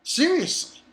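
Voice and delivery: high, falling exasperation; incredulous. Acted. Reader fed up with Cohen, incredulous. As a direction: pitch it up in disbelief.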